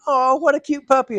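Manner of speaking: put-on high sweet baby talk to a dog; cooing at a puppy. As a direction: coo at the little dog.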